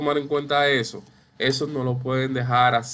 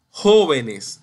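JÓ-venes, first syllable punched louder; correct stress on first syllable; 6 dB louder than usual. Shown as a demonstration. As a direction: stress "jóvenes" on the opening JÓ. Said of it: "no jóvenes, ni jóvenes".

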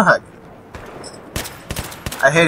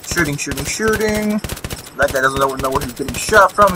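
normal talking voice

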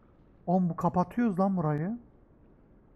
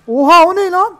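sudden high startled cry; shocked yelp; 10 semitones higher, 12 dB louder than usual. Real, not acted. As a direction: gasp it out in shock. Shocked at a creature.

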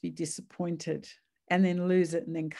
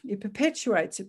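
ordinary delivery

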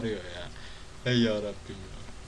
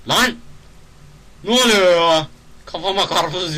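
startled shout; 8 semitones higher, 11 dB louder than usual. Real, not acted. Watermelon falls on his head.

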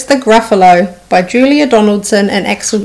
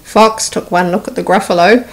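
usual speaking voice

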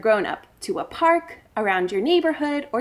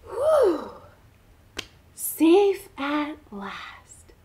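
relieved; 3 semitones higher, much slower than usual. Acted. Relief after escape.

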